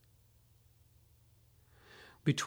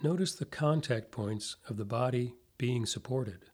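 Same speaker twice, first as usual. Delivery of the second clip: guided meditation; 4 dB quieter than usual. Acted. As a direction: ease them into calm, softly, with long pauses.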